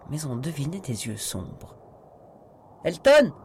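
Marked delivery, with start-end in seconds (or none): 2.8-3.3 loud, high-pitched call; shouting. A narrator's reading. Voice from inside the house calling a man outside, shouting. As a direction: shout the name out to summon him. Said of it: "cria une voix de l'intérieur".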